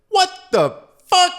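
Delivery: slow, punched-out yell of disbelief; angry agent's bellow. Retold or imitated. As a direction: imitate an angry agent's loud, high outburst. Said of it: "that T S A agent was so angry".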